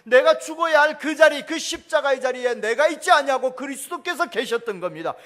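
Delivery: loud, high, impassioned sermon delivery; full-force preaching. Acted. Pastor preaching on the cross, loud and high. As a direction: proclaim it to the whole congregation.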